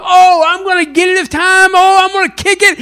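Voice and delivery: high, loud, hopeful self-pep talk; eager Charlie Brown. Retold or imitated. Charlie Brown psyching himself up to kick, eager. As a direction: pump yourself up like a kid. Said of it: "Charlie Brown gears himself up".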